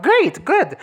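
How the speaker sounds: high, loud mock enthusiasm; sarcastic. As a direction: fake cheer, rolling her eyes in the voice. Sarcastic reply to a flat villain.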